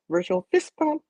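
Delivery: squeaky fist bump call; high playful. Shown as a demonstration. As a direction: pitch it way up, playful. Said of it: "let me do it like this".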